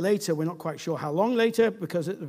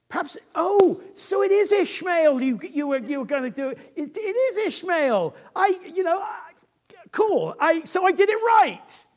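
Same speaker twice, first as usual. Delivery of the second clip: higher and louder, eager and relieved; excited Abraham; 11 semitones higher, 7 dB louder than usual. Acted. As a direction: een opgewonden Abraham, hoger en luider. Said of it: "So Abraham said to God".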